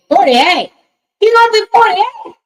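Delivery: high, loud gasp of surprise; can't believe he's forty-eight; jaw-drop shock, way up high; shocked disbelief. Real, not acted. Surprised at son's age.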